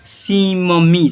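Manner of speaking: slow, drawn-out सीममी with a held double m; slow syllable-by-syllable spelling voice; sounding out the double m. Shown as a demonstration. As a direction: stretch सीममी so the doubled m rings out. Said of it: "सिम्मी ऐसे लिखेंगे".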